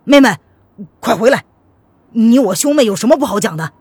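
loud, urgent call to a sister. A narrator's reading. Brother calls his sister back from the cliff edge, calling out. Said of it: "钱世俊叫道".

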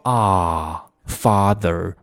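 "啊" long and open, as in "father"; wide, lengthened "啊" sound; long ah vowel. Shown as a demonstration. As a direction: draw out the ah in "father". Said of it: "不是那个音".

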